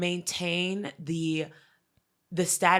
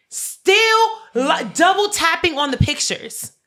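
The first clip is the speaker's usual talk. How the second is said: loud, high, incredulous outrage; outraged; 9 semitones higher, 8 dB louder, slower than usual. Real, not acted. Woman exposing sister still following her abusive ex, outraged. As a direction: hit it hard in disbelief.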